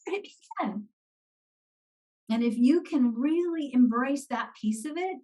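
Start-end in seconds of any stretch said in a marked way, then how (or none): 0.1-0.9 high, soft mock-excited voice; pitched-up, hushed playful plea; eager client. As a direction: imitate a delighted client asking for more. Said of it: "stand up and go".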